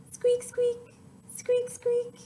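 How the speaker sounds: very high tiny squeak; high mousy squeaking; mouse squeaks. Shown as a demonstration. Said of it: "listen to its funny sound".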